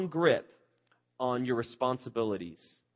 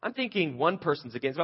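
ordinary talk, nothing marked

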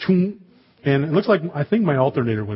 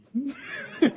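high giggle; 13 semitones higher, 5 dB quieter than usual. Real, not acted. Laughing at grumpy seatmate.

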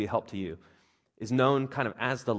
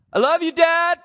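put-on much higher, louder little-boy voice; son Levi's voice; 12 dB louder than usual. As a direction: squeak it like the four-year-old. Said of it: "Levi talks like that normally".

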